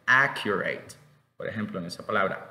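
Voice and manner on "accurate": "accurate" with an open first A; open-mouthed A starting "accurate"; pronunciation model. Shown as a demonstration. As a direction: model "accurate" clearly for learners. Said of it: "la pronunciación es accurate".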